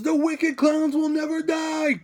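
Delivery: high, drawn-out mock battle cry; retold yell. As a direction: yell it out high, stretching the words. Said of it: "just yells".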